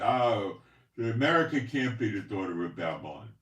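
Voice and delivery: loud, scoffing mock of a doubter. Mocking viewers who deny America is Babylon, loud scoffing. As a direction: throw their denial back at them, hammed-up. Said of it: "And a lot of you".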